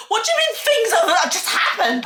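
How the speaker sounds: alarmed question. Retold or imitated. She challenges her date's vague story, alarmed and high. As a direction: blurt it out, alarmed.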